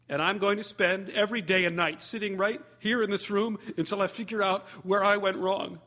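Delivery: mock-tragic wounded pledge; a hint of heartbroken roommate. Roommate after breakup, lightly voiced heartbroken vow.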